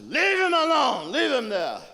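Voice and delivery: high, full-voiced shout; cried out. Ordering people off Leon's body, loud cry. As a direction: shout it out at the top of the voice. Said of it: "And she cried".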